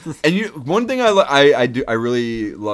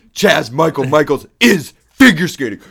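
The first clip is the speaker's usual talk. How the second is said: louder, ending on a shouted boom; brag, then bang out boom; boastful quote; 5 semitones higher, 14 dB louder than usual. Retold or imitated. Quoting Chaz's defense.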